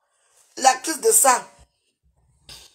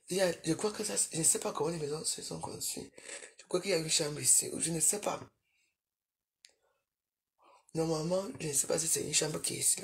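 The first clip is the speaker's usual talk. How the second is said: much softer, lower retelling; hushed telling; 6 semitones lower, 14 dB quieter than usual. Real, not acted. Telling her mother about the noise, hushed. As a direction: tell it low, almost under your breath.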